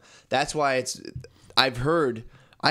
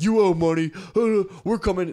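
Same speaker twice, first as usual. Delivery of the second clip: higher, louder mock threat; mock threatening collector; 7 semitones higher, 5 dB louder than usual. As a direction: bark it like a menacing caller.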